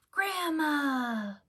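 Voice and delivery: joyful surprise at seeing grandma; delighted gasp. A narrator's reading. Pete sees grandma from train.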